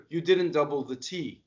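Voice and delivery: ordinary talk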